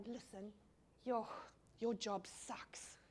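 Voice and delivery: a hush of sympathy for an airport worker; confiding quietly. Retold or imitated. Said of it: "To say to someone".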